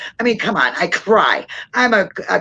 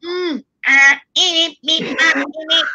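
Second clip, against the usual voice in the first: mock alien babble, pitched higher; alien noises; 10 semitones higher, 3 dB louder, slower than usual. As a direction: squeak out nonsense syllables like a little alien. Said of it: "if they go".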